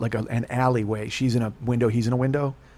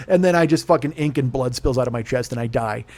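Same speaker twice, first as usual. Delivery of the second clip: ordinary talk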